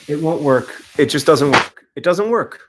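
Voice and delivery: loud, exasperated repetition; frustrated outburst. Real, not acted. Failing to stream the match, a loud frustrated outburst. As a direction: vent at the broken stream.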